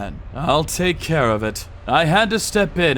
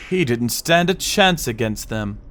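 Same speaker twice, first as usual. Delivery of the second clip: ordinary talk, nothing marked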